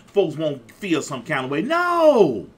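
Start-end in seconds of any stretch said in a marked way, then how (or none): 1.6-2.5 high, loud drawn-out NO; emphatic cry. Real, not acted. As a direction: cry it out from the pulpit. Preacher's emphatic refusal in sermon.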